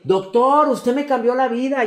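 high, loud, gushing call; fan shouting across the plane. Retold or imitated. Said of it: "a la que gritaba". (grito de fan emocionada, agudo y fuerte)

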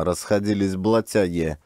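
usual speaking voice

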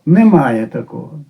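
usual speaking voice

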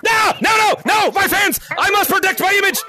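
high, hammed-up outcry; mock dramatic protest. Streamer protecting their image before fans, mock dramatic shout. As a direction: cry out high in mock alarm.

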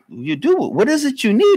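rising to a high, playful question; father's mock surprise. Father teasing the cousin with a high, surprised question. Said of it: "My father said".

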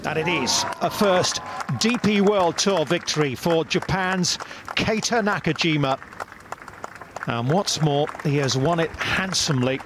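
rising, proud victory call over music; golf commentator. Acted. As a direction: announce the winner with lift.

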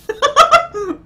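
squealing laugh; helpless high-pitched laughter; real laughing fit; high burst of laughter. Real, not acted.

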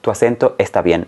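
usual speaking voice

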